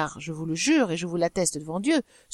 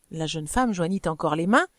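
plain reading voice

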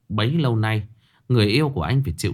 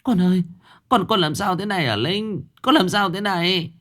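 high, whimpering plea; about to cry over her pale daughter; a mother near tears at her daughter's hospital bed; tearful mother; 8 semitones higher than usual. Acted. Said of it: "bà Lân mếu máo".

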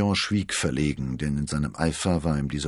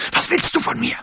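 much higher, venomous hiss; 9 dB louder than usual. A narrator's reading. Milady hissing hatefully at Athos. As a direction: spit the question at him. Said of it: "zischte sie".

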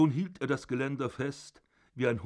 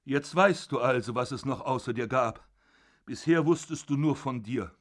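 loud, solemn sentence of death; louder, condemning; 2 semitones higher, 5 dB louder than usual. Acted. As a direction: pronounce the verdict, rising in force. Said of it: "Und lauter". (lauter, verurteilend)

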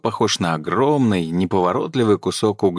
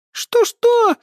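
very high, loud outraged squeal; outraged shriek; 6 dB louder than usual. A narrator's reading. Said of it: "возмущённо взвизгнул эльф".

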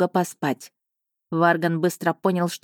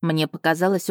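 ordinary delivery, nothing marked

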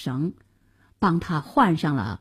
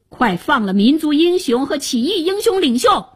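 higher, insistent order; commanding; 6 semitones higher, 3 dB louder than usual. A narrator's reading. Hero orders the kowtowing chancellor, commanding voice. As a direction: order their release with authority.

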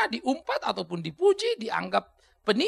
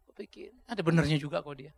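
ordinary delivery

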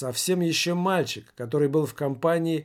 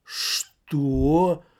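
drawn-out menacing question; threatening; 3 dB louder than usual. A narrator's reading. Man squares up to the drunk welder, threatening. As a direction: stretch the word as a warning. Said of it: "угрожающе проговорил тот мужик".

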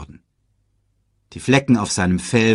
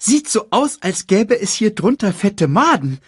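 high, quick cartoon meerkat; chirpy little sidekick voice; 10 semitones higher, 4 dB louder than usual. Timon speaks.

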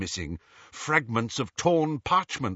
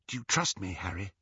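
soft, secretive asking; hushed question; 5 semitones lower, 6 dB quieter, faster than usual. A narrator's reading. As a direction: ask it under your breath. Hiding companion asks in a hush.

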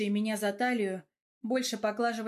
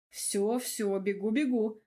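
plain reading voice

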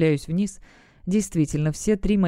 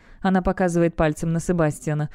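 plain reading voice

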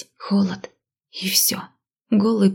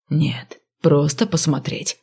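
low, quiet sarcastic snap; snapping; 4 semitones lower, 3 dB quieter, slower than usual. Acted. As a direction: bite back sarcastically. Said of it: "огрызнулся следователь".

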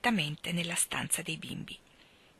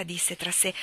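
ordinary delivery

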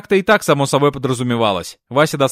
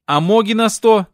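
higher, drawn-out indignant challenge; mildly riled, pitched up and slow; flaring up; 6 semitones higher, 3 dB louder than usual. A narrator's reading. Comrade pounces on Akim's spending list, mildly riled. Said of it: "тут же взвился короток".

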